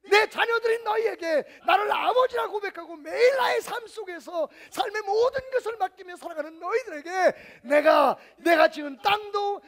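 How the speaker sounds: shouted, high, slow and climactic; preaching at full force. Acted. Pastor proclaiming God's promise to the congregation, shouting. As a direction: preach it to the rafters.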